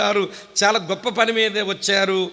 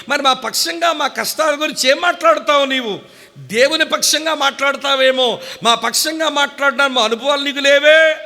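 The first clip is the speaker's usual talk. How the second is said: rising, louder challenge building to the last question; scolding the angel, each line louder; indignant rebuke; 5 semitones higher, 5 dB louder than usual. Acted. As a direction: confront the angel, voice climbing. Preacher rebukes the angel Gabriel again.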